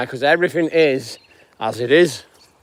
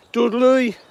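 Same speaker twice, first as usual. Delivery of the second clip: higher-pitched Birmingham accent attempt; 6 semitones higher than usual. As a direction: have a go at saying it Brummie. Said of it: "try and do a Birmingham accent".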